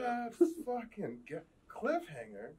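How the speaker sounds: high, slow incredulous; mock disbelief. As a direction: drag it out in disbelief. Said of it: "you gotta leave it on the cliffhanger".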